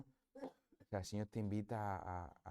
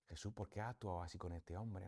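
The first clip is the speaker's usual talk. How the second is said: prayerful question; 3 semitones lower, 8 dB quieter than usual. Acted. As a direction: softly put question to Jesus. Imagined question put to Jesus in prayer.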